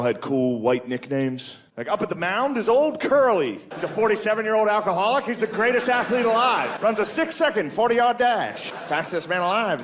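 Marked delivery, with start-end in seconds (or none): 1.8-9.8 high-pitched vintage ballgame announcer; old-time radio announcer. Acted. Announcer welcoming listeners to the game, old-timey. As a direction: open the broadcast in an old radio voice. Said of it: "he knocked that voice out of all the whites".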